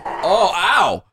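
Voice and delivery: high, sudden ow; yelp of pain. Real, not acted. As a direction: cry out as if hurt. Foot grabbed during horseplay.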